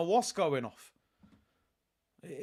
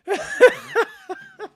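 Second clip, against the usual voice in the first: high burst of laughter; 9 dB louder than usual. Real, not acted. Laughing at pundit's mispronunciation.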